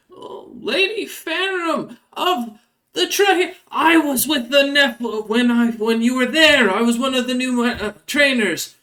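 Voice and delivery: high, breathless fanboy squeak; a high, slow, giddy rat guard meeting his hero; starstruck rat folk. Acted. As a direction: gush up high like a nervous little rat fan.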